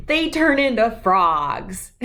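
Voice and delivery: said through a laugh; a kid laughing at Dad's mistake. Acted. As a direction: giggle while correcting him. Child corrects Dad, laughing.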